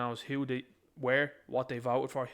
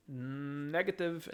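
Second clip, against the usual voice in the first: drawn out; much slower than usual. Real, not acted. Drawn-out verdict.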